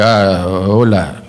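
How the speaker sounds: slow, low mock Spanish accent; broken Spanish. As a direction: drag out bad Spanish. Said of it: "horrifically broken Spanish".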